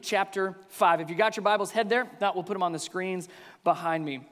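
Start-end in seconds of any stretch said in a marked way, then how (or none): none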